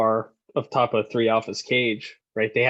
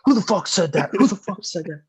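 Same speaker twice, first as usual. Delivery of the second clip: higher-pitched indignant demand; mock outrage; 7 semitones higher than usual. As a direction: act out an incensed superior. Angry superiors hunting the prankster.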